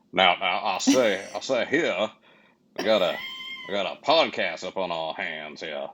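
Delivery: a hint of a folksy Southern gentleman; Kentucky colonel. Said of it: "Kentucky colonel".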